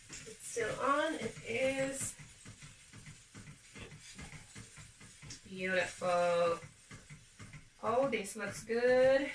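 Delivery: slow, lightly put-on Southern American accent; a loose, half-joking Southern drawl over the pot; playful Southern accent. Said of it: "I don't know why I'm talking like this. I'm Southern now".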